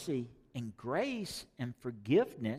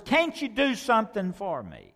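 pleading; 7 semitones higher, 5 dB louder than usual. Acted. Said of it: "In other words".

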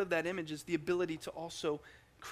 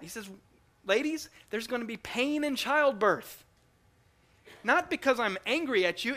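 rising, louder preaching as God speaking; impassioned sermon voice; 5 semitones higher, 4 dB louder than usual. Acted. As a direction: preach it with growing force. Preaches God's words.

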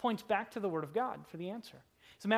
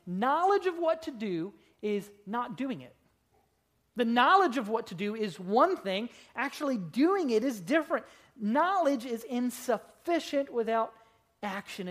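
louder, ringing pulpit emphasis; full-force preaching; 3 semitones higher, 4 dB louder than usual. Acted. Preacher driving home knowing versus doing, loudly. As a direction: hammer the point home to the congregation.

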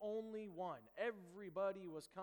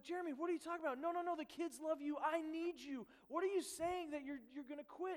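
higher, urgent pleading; retold pleading, raised and insistent; 9 semitones higher than usual. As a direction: beg him not to leave. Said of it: "So I'm like".

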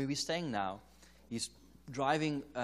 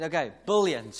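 loud repeat; 3 semitones higher, 10 dB louder than usual. Retold or imitated. Loudly repeating the corrected word.

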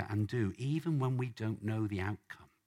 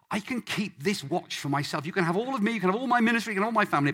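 rising higher and louder with each offer; building defiant bargain; 10 semitones higher, 7 dB louder, faster than usual. Acted. Man bargaining with God, building to a defiant cry. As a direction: plead harder and harder.

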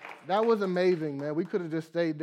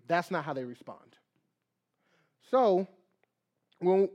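ordinary talk, nothing marked

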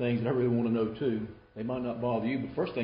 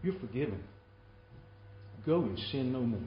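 sincere forgiveness; 6 dB quieter than usual. Acted. Jesus to the woman, gently forgiving without conditions. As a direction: let each phrase settle gently. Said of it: "He said".

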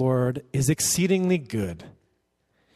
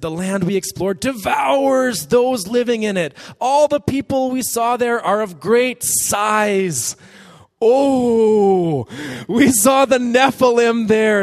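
fearful spies; 8 semitones higher, 6 dB louder, slower than usual. Acted. Frightened spies voiced.

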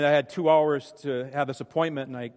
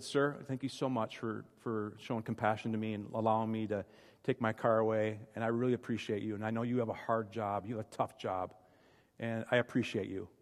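soft, sincere thanks, much quieter; gentle word; 4 semitones lower, 9 dB quieter than usual. Retold or imitated. Thanking the upset tow truck driver, soft and sincere. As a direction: speak gently to calm a stranger. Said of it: "he needs a gentle word".

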